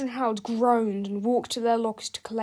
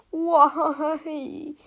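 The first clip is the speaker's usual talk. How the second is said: raised, drawn-out wail; wailing cry; 5 semitones higher, 3 dB louder than usual. A narrator's reading. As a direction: wail it in mock despair. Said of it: "cried George".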